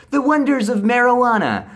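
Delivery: high, loud, stretched-out proclamation; hammed-up dreamy grandeur; mock grand lecturer. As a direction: announce it like a wondrous marvel. Mocking the dude's lecture.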